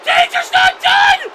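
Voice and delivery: very high, very loud shout; real full-throated shriek; triumphant scream. Real, not acted. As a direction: scream that your favourite is still alive. Commentator erupts at wrestler's survival.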